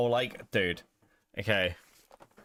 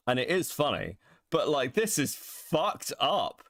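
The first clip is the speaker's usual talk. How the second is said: usual speaking voice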